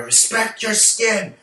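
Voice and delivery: high, slow scolding shout; shouting father. Retold or imitated. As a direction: shout it at your daughter as a strict father. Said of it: "you shout at your daughter".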